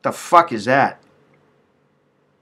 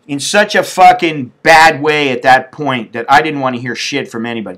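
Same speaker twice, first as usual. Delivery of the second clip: ordinary talk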